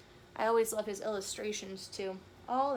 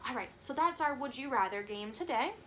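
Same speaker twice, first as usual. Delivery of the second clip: usual speaking voice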